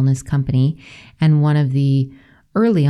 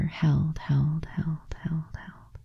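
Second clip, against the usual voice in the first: repeated whisper of held, fading softer; whispered word; 10 dB quieter, much slower than usual. Shown as a demonstration. Host echoing the word in her drawing, fading whisper. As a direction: breathe out held, softer each time.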